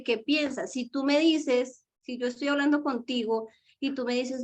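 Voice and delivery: normal talking voice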